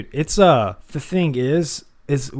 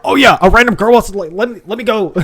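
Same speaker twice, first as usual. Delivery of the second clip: loud, high, over-eager yelling; hammed-up thrilled shout, breaking into laughter; mock excitement; 10 semitones higher, 9 dB louder than usual. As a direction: act ridiculously eager, loud and pitched way up. Mock eagerness at random request.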